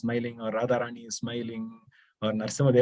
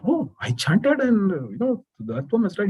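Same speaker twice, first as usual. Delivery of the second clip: high-pitched, slowed alarmed outcry; agitated sage; 8 semitones higher than usual. Acted. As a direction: sound shaken that the worm died. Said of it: "becomes, you know, agitated".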